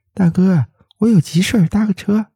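high, friendly ask for a lift; smiling request. A narrator's reading. Young woman asking the driver for a lift, smiling. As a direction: sweet-talk the driver into a ride. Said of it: "她对司机笑笑说".

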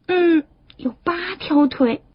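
put-on slow, high childlike reckoning; tadpole children. Tadpoles counting the crab's legs, slow and high. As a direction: count it out slowly in a little voice.

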